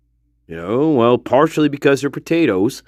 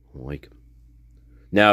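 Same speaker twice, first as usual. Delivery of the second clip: usual speaking voice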